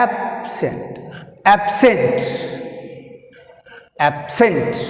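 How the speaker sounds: "absent" with a firm SENT; ab-SENT, "absent" drilled with end stress; stress on second syllable. Shown as a demonstration. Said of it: "Second syllable is scent".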